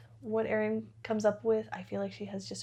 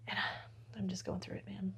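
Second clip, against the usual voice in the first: whisper; 12 dB quieter, faster than usual. Real, not acted. Tired aside, whispered.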